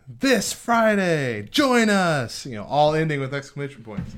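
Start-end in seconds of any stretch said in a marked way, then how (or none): 0.1-2.4 high, very slow and eager; a high, stretched-out bulletin pitch; excited announcement. Shown as a demonstration. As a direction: invite the whole church brightly. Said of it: "read it with that excitement".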